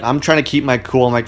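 normal talking voice

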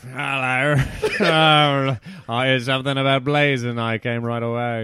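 bumbling British politician voice; Boris Johnson. Acted. Prime minister turning up about blazing, bumbling British bluster. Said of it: "Boris Johnson in".

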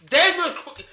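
laughing through the words. Real, not acted. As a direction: crack up while talking. Laughing at quick reply.

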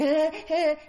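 imitated high, loud nervous giggle; nervous laughter. Retelling how her timid old friend would react, imitated nervous laugh. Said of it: "he laughed a lot because he was nervous".

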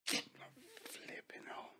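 unvoiced whisper under breath; whispered dread. Real, not acted. In the packed barber shop, whispers in dread. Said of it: "dread in barber chair".

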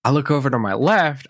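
ordinary delivery

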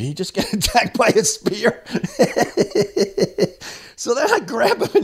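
breaking into high laughter mid-question; real laughing. Real, not acted. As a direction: crack up while finishing the question. Storyteller cracks up at absurd thought.

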